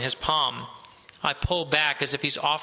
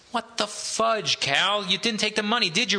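Roosevelt's outraged voice; 6 semitones higher than usual. A narrator's reading. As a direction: stretch it out in shock, pitched up. Roosevelt scolds Cal in van.

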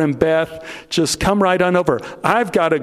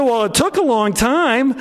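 higher, lightly voiced girlfriend; unimpressed girlfriend; 8 semitones higher than usual. Beth brushes off [name]'s hostage story: higher, unimpressed. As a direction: shrug it off, higher. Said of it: "She said".

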